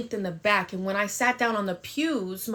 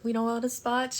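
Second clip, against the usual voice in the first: ordinary delivery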